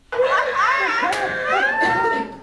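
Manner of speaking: very high laughter. Real, not acted. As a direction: crack up at the pun. Laughs at own joke.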